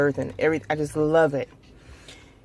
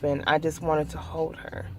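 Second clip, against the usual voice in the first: softer, fading, near tears; quiet grief; 6 dB quieter than usual. Real, not acted. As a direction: let the words sink into sorrow. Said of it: "try not to cry".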